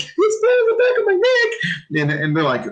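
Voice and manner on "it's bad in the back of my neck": much higher, whimpering mock cry; imitating crying kid. Veteran imitating a recruit in the gas chamber, in a high whimpering mock cry. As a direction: wail it like a panicked recruit in tear gas. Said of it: "this kid's already dancing like".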